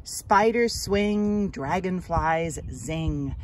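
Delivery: put-on slow, expressive read-aloud for children; gentle storybook narration, drawn out; story narration. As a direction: read it like a picture book to little kids, lingering on each line. Reads picture book aloud.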